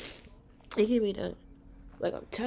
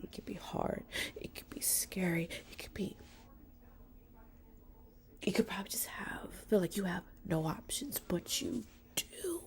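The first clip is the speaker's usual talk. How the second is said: whispered, much softer comfort; 10 dB quieter than usual. Real, not acted. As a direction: hush it down, like a secret to a friend. Soft reassurance to struggling viewers.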